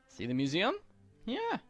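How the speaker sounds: ordinary delivery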